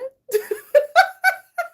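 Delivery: loud, high cackle; laughing. Real, not acted. Reader reacting to the partner's shocked reply, loud laughter.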